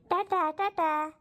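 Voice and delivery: slow sing-song bye. Acted. Mother waving children off.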